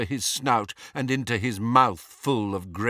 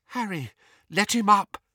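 usual speaking voice

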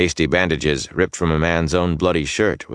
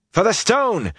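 high-pitched soldier's battle cry; contained narrator's shout; shouted war cry. A narrator's reading. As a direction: answer the rallying call, pitched right up. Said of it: "a man shouted".

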